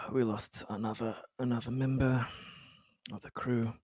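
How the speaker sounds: soft, sighing, halting loss; weary grief. Acted. Captain mourning another lost crew member, subdued and weary. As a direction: sigh it out heavily and quietly.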